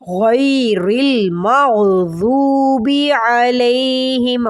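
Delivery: slow chant, pressing the ya of وإياك; recite, leaning on the shadda; stressed recitation. Shown as a demonstration. Teacher reciting with the stressed ya. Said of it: "غی کر کے اس کو پڑھیے".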